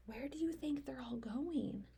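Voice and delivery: soft, whispery wondering; hushed question. Acted. Reader asks a child where the animals go, hushed. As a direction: whisper the question to a small listener.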